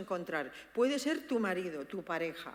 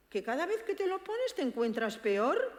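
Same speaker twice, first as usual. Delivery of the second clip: "pones" pitched high in disbelief, "peor" rising and louder; incredulous tone; 7 semitones higher, 4 dB louder than usual. Shown as a demonstration. Daughter doubting her father's complaint, rising incredulous tone. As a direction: question "cada vez que te lo pones" with a sharp doubting rise. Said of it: "Ese tono de".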